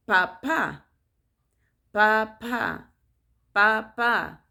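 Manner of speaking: "Papa" with the last A stressed. Shown as a demonstration. Teaching parents Spanish for dad, "Papa" stressed on the last A. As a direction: lean on the final A of "Papa". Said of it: "with an accent in the last A".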